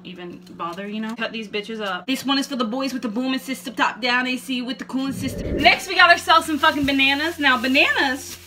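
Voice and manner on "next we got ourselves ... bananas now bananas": excited host. Acted. Hyping up the next fruit.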